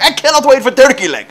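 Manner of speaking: high, loud, hammed-up Russian yell; yelling Russian accent. Angry customer yelling at the counter lady, Russian accent. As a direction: bellow impatiently in a mock-Russian accent. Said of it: "this guy's, like, yelling at her".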